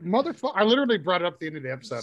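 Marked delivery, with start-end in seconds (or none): 0.0-0.5 high-pitched exasperated curse; mock exasperation. Retelling his reaction to the guest's vowel, mock exasperated. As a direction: pitch jumps up in mock disbelief.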